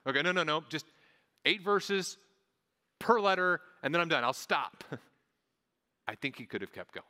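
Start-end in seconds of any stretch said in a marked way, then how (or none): 0.1-4.8 louder, higher mock self-restraint; constraining himself. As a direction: act out reining yourself in mid-enthusiasm. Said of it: "did it to constrain himself".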